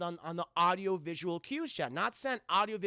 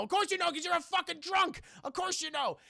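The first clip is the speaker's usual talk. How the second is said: high, scornful outburst; imitating a very mad man's retort; retold anger; 9 semitones higher than usual. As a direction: throw it back in mock fury. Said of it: "And he's very mad".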